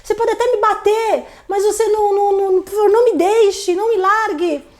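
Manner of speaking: put-on high, pleading voice of a begging girlfriend; fake desperate pleading. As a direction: beg him high and breathless, putting it on to calm him. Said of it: "Eu ainda falava desse jeito".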